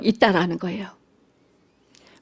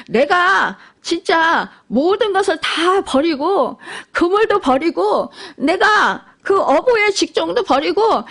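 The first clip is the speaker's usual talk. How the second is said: raised, driving sermon voice building up; preaching at full force; 7 semitones higher than usual. Acted. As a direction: press the point harder and harder. Preacher voicing selfish believer, rising.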